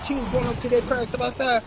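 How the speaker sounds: ordinary delivery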